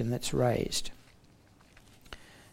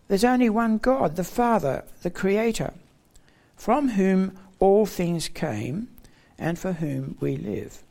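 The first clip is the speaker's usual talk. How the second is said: "only" raised high and stressed; emphatic creed, slowing at the end; emphatic declaration; 3 semitones higher than usual. Shown as a demonstration. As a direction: proclaim it with weight on "only". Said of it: "and emphatically in verse six".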